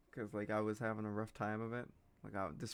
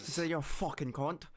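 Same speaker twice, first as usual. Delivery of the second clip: raised, playful Irish swearing; cussing Irish accent; 4 semitones higher, 4 dB louder than usual. Shown as a demonstration. Showing that Irish cussing is fun, Irish accent. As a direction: curse in a thick Irish brogue. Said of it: "cussing with an Irish accent's fun".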